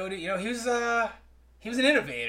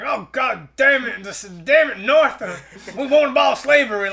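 mock grumbling cowboy curse, higher and louder; cursing Yosemite Sam; 6 semitones higher, 5 dB louder than usual. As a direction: sputter cuss words like a sore Confederate. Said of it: "He curses underneath his breath".

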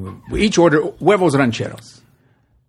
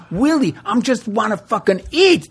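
higher, fed-up plea; begging them to stop so he can eat; a hungry bandmate pleading with Willie, exasperated; 9 semitones higher than usual. Retold or imitated. Narrator pleading amid argument.

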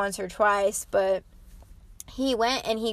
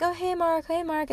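imitating a cheery higher-pitched hello to a regular; cheery greeting; 8 semitones higher than usual. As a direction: call out brightly like staff greeting a favourite customer. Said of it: "they would be like".

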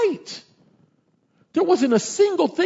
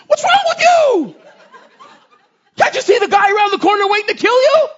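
loud, high, laughing donkey voice; talking donkey; 12 dB louder than usual. Acted. Donkey scolding the blind prophet, high laughing shout. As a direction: play the donkey, exasperated and amused. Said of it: "the donkey turns around and he goes".

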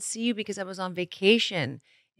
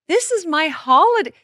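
excited; 11 semitones higher, 6 dB louder than usual. Real, not acted. Showing off holiday outfit.

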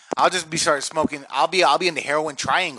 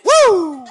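big, high, loud holler; mock country whoop; 12 dB louder than usual. Comic mocking cousin country, whooping loudly. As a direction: let out one long rowdy whoop.

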